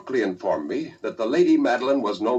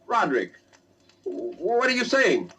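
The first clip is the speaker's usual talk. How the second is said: alarmed question; 8 semitones higher, slower than usual. Acted. Narrator alarmed at Roderick.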